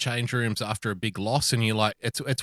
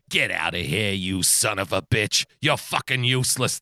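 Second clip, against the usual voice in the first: gruff put-down; 4 semitones lower than usual. Retold or imitated. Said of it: "This is the way he talks to me".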